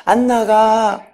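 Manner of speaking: slow, droning demon imitation; changed possessed voice. Possessed student refuses the praying leaders, mock demon voice. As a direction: drag it out in an altered, held tone. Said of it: "목소리가 막 변하기도 하면서".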